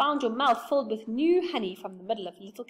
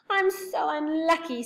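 sighing; 8 semitones higher, 5 dB louder than usual. Acted. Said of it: "sighed Beth".

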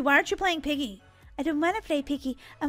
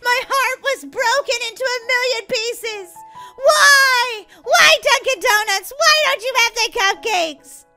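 loud, high mock wail of heartbreak; melodramatic; 6 semitones higher, 7 dB louder than usual. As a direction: lament it like a tragic heroine. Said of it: "Mister Dramatic".

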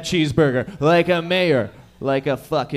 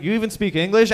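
louder, higher fed-up cop; exasperated cop; 5 semitones higher, 5 dB louder than usual. Retold or imitated. Cop losing patience, louder exasperated question. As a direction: snap it like an impatient officer.

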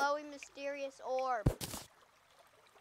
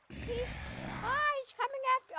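excited; 11 semitones higher than usual. Real, not acted. Zombie chasing him in game.

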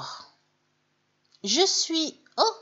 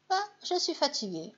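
mild tired sigh; 6 semitones higher, 5 dB quieter, faster than usual. Shown as a demonstration. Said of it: "Mais là, c'est je suis épuisée".

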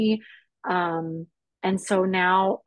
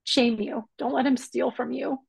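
choked up, pitch lifted, pleading; real emotional voice; 7 semitones higher than usual. Real, not acted. Survivor to anybody listening, emotional reassurance. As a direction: speak through welling emotion, in short phrases. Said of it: "I'm gonna get a little emotional now".

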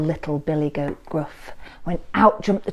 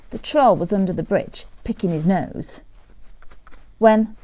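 plain reading voice

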